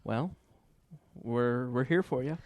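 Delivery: ordinary talk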